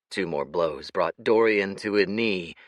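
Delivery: usual speaking voice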